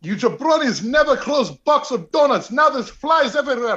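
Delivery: put-on high, loud Iron Sheik-style Arabic accent. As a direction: belt it out in a thick Arabic accent. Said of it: "Arabic accent".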